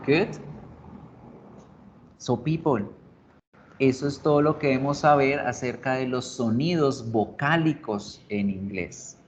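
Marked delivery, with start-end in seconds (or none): none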